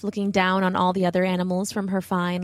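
ordinary delivery, nothing marked

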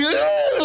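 mock squeal. Las amigas reaccionan al verlo.

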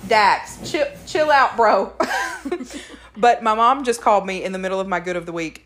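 usual speaking voice

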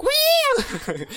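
very high, loud squeal. Retold or imitated. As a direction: imitate a friend's piercing squeal. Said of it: "he would, like, squeal".

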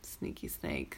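a hissed whisper. Retold or imitated. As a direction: breathe it out in a whisper. Mocking a sneaky contestant.

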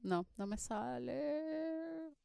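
half-hearted mock whimper; mock sad. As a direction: playfully lament the failure, hushed. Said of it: "Qué tristeza".

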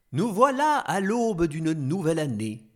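normal talking voice

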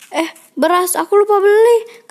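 suddenly remember in a panic; rising, alarmed outburst; startled realization. Acted. Realizes she forgot the errand.

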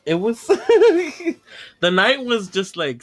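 laughing. Real, not acted. Laughs while telling.

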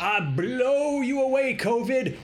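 high, slow, booming preacher voice; televangelist impression. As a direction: channel a faith healer. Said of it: "He's the one that".

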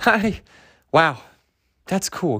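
ordinary delivery

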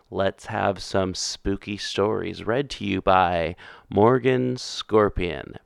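usual speaking voice

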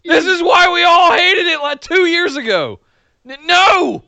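high, shouted venting; exasperated outburst. Acted. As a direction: let the pent-up frustration burst out high. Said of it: "I had to get that out".

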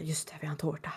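relieved line whispered on an out-breath. Retold or imitated. Kenzie relieved to Hale about the cake, whispered. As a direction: sigh it out in a whisper. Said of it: "andas ut och bara".

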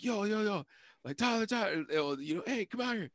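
high-pitched, urgent summons; retold calling out. Friend calls Tyler out of the house, retold calling out.

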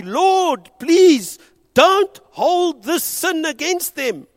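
high, loud, slow plea. A narrator's reading. Preacher voicing dying Stephen calling out loudly to God. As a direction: cry it out, pleading high and slow. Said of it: "he called out loudly".

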